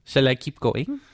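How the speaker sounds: put-on German accent. Host needling co-host, in a German accent. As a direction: ask teasingly in mock German English. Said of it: "I start talking like this".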